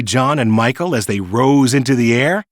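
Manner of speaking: usual speaking voice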